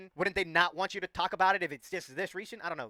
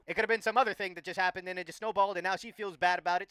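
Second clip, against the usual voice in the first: ordinary talk, nothing marked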